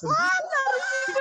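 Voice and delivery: put-on high, slow, shrieking old lady's joy; excited old lady. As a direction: squeal it like a thrilled old lady. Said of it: "todas las viejitas bien emocionadas".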